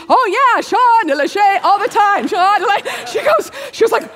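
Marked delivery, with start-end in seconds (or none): 0.1-0.6 high, loud mock enthusiasm; acted eager reply. Kim answers the instructor, high and loudly eager. 0.6-3.1 high-pitched mock tongues prayer; praying in tongues. Kim shows the instructor her tongues, high and eager. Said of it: "praying in tongues".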